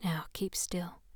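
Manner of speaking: soft unvoiced whisper. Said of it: "she whispered".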